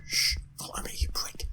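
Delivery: whispering to the bank manager in the game; mock whisper. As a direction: pretend whispered threat. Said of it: "like, go up to him and go".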